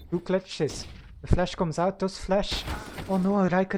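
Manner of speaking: live play-by-play commentary; esports caster. Real, not acted. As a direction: call the match round by round.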